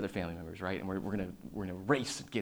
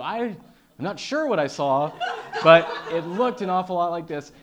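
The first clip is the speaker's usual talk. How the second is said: higher and louder, laughing through it; a bewildered witness, cracking up; laughing eyewitness; 9 semitones higher, 15 dB louder than usual. Retold or imitated. Eyewitness calling police, laughing. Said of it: "They went".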